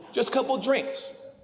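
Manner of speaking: coaxing brother, drawn out. Retold or imitated. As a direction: stretch it out like talking someone into a night out. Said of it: "this is how Dustin gets me to go out".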